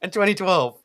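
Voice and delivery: high, loud, fast hype voice; mock announcer. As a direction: ham up the campaign pitch. Mocking election hype.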